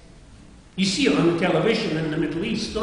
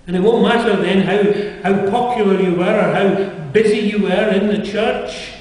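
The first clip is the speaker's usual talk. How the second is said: forceful preaching; 4 semitones higher, 7 dB louder than usual. Acted.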